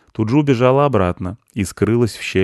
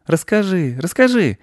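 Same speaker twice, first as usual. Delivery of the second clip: high, eager begging; pleading boy; 11 semitones higher than usual. A narrator's reading. Mark begs the squirrel for a story, pleading. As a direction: beg for it in a high kid voice. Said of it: "попросил Марк".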